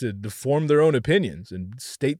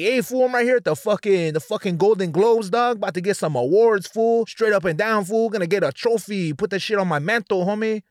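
put-on loud, higher Chicano homie accent; Cheech Marin; 7 semitones higher, 4 dB louder than usual. The not-so-cool Spanish accent example, Chicano homie accent. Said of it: "Like fucking Cheech Marin and shit".

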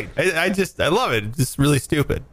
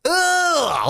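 high, shuddering ugh; drawn-out sound of disgust; 12 semitones higher than usual. Real, not acted. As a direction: groan with a shiver of revulsion. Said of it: "I got a shiver thinking about 'em".

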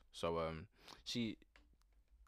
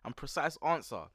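exasperated pleading; 9 semitones higher than usual. Retold or imitated. Retold plea to stylist.

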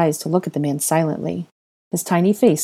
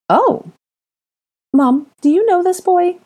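very high, louder exclamation and question; start, then ask Mom urgently; quick startled question; 6 dB louder than usual. Acted. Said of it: "Ginny asked quickly".

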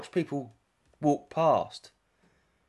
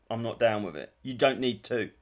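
ordinary talk, nothing marked

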